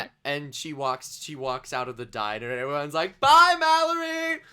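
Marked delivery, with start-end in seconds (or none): 3.2-4.4 high, loud cheering goodbye; crowd's farewell. Acted. Soldiers see granny out of the diner, cheering shout.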